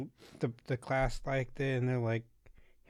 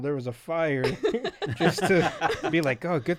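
louder, higher joking exaggeration; mock dramatic lie; 4 semitones higher, 7 dB louder than usual. Boyfriend consoling someone who missed out, mock-dramatic and loud. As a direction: play up a fake disaster, amused.

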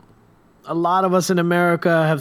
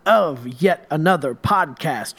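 podcast intro; 4 semitones higher than usual. Acted. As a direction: welcome listeners like a host.

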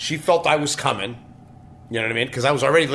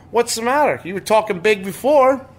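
mock teasing woman; 8 semitones higher, 4 dB louder than usual. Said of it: "She's like".

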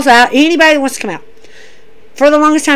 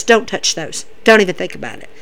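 low, hushed warning, much softer; quiet warning; 5 semitones lower, 6 dB quieter than usual. Retold or imitated. Regal warning Johnny off his brass knuckles, hushed. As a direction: warn him off quietly, barely above a murmur. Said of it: "Regal looks at him and goes".

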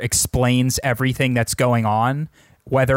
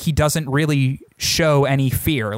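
ordinary delivery

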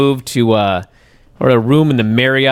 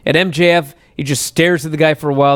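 ordinary talk, nothing marked

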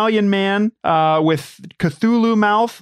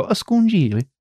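Italian-accented pronunciation, slightly raised; higher, showy Italian accent; 4 semitones higher than usual. Shown as a demonstration. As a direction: say it with Italian relish. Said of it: "with an Italian accent".

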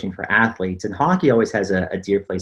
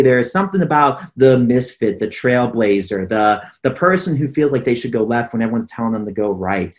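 ordinary delivery

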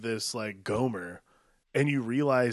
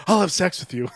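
higher, louder imitation of an over-the-top plea; mock angry outburst; 6 semitones higher, 7 dB louder than usual. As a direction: blurt it out, mock-intense. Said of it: "her reaction to everything is".

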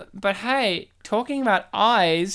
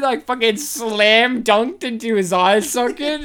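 ordinary delivery

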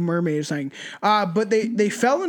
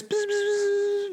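high, slow buzzing fly noise; 12 semitones higher, 4 dB quieter than usual. Retold or imitated. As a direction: buzz like a tiny fly. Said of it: "Bart's a fly".

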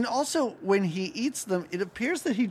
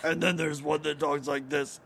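other ghost voice; 2 semitones lower than usual. Retold or imitated. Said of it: "Very good ghost imitations".

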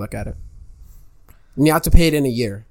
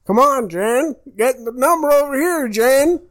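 raised, higher mock yell at a contestant; Bob Barker screaming; 10 semitones higher, slower than usual. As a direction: bark the name up high like an angry host. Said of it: "he's just screaming at this lady".

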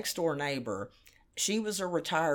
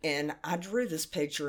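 usual speaking voice

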